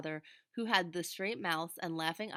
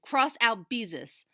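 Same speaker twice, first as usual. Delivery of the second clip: slow, loud, high shout; 5 semitones higher, 9 dB louder, slower than usual. A narrator's reading. Furious little sister yelling at big sister, loud shout. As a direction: fling it at her sister in fury. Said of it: "and shouted".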